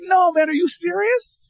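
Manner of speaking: excited disbelief. Retold or imitated. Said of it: "And I'm like".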